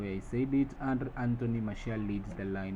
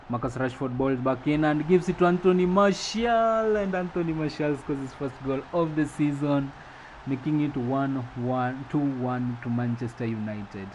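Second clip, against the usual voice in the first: excited play-by-play; 5 semitones higher, 7 dB louder than usual. Acted. Commentator calls Martial's first goal of the season, excited play-by-play. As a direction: build to a loud, high, stretched name at the goal. Live call of second goal.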